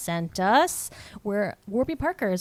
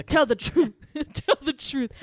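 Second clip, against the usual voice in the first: higher, punchy mock plea; movie line impression; 6 semitones higher, 3 dB louder than usual. Host quoting a favorite film line, hammed-up impression. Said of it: "him just saying that line".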